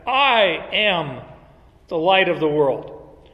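"I am" lifted, then "light" falling firmly; emphatic I am. Shown as a demonstration. Teacher reading Jesus's emphatic claim to the class. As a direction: stress "I am", settle on "light".

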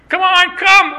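shepherd calling his sheep home. Retold or imitated. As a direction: call out across the field to the flock.

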